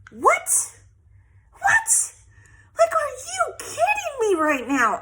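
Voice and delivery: high-pitched, loud incredulous outburst; reliving her outrage at full pitch; angry disbelief. Retold or imitated. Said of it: "I was angry".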